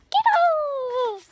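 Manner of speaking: high, loud shouted Skittles. Retold or imitated. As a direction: yell the word out. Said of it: "and going".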